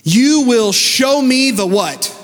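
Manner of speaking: fervent, lifted sermon voice over music; preaching. Acted. Pastor preaching to the congregation, full fervor.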